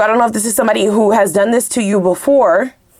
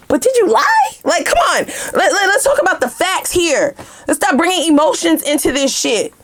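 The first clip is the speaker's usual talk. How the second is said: confronting a liar; 7 semitones higher than usual. Pretend.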